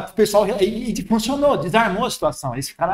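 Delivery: usual speaking voice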